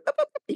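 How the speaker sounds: very high yappy boops like a little dog; high-pitched doggy boops at the door; imitated dog bark. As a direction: bark it like a small excited dog. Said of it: "I hear a barking".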